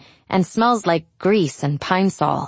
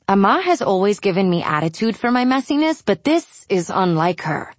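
ordinary delivery, nothing marked

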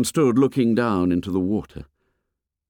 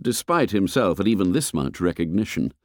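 usual speaking voice

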